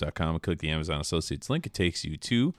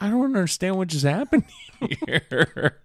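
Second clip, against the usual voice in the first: high, loud, bewildered disbelief; stunned; 11 semitones higher, 4 dB louder than usual. Retold or imitated. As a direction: sound completely baffled. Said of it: "in shock".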